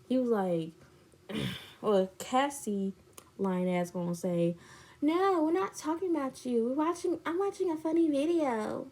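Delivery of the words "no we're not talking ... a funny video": higher, put-on voice of denial; mock innocent denial. Boyfriend denies talking about her, mock innocent. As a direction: deny it in a high mock voice. Retelling a liar's excuse.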